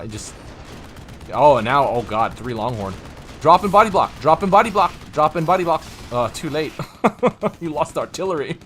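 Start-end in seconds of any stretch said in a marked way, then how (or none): 3.4-5.8 urgent call. Real, not acted.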